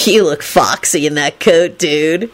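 slow, moony drawn-out moan; slow besotted dude voice; lustful moan. A narrator's reading. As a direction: moan it with smitten longing. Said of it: "he moaned lustfully".

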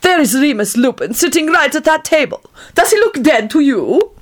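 haughty Egyptian woman. A narrator's reading. Egyptian woman to her companion, high haughty voice. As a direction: look down your nose, voice lifted.

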